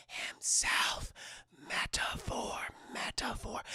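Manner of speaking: hushed, drawn-out whisper; dramatic whisper. Real, not acted. Narrator revealing the symbolism to chat, dramatic whisper. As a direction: whisper it like a deep secret revealed.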